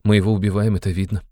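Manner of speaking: ordinary delivery, nothing marked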